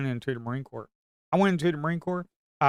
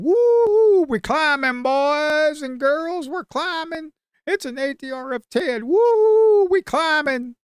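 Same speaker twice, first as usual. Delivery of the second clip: much higher and louder whooping; excited cheering; 14 semitones higher, 8 dB louder, much slower than usual. Real, not acted. Trader cheering his viewers as the market climbs, excited whoops. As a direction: whoop it up like the chart is flying.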